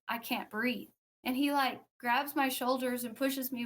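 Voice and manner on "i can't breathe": ordinary delivery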